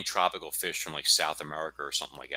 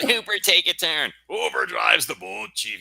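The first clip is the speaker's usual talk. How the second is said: quoting Jaws; 7 semitones higher, 6 dB louder than usual. Retold or imitated. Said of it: "I would quote Jaws lines".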